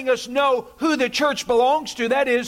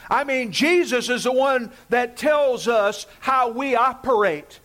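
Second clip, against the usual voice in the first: preaching shout. Real, not acted. Preaching emphasis.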